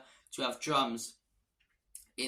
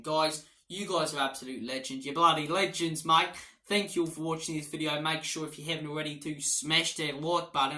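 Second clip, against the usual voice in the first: light mock Australian accent, louder; a fan playfully slipping into Australian accent; 3 semitones higher, 6 dB louder than usual. Spurs fan thanking viewers, lightly mock Australian accent. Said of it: "I don't know why I'm talking in Australian".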